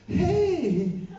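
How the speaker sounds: a loud, high, laughing "Hey!" from the fan at the door; cheery fan greeting. Retold or imitated. Fan at the hotel door greets him with a high laughing hey. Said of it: "she's like".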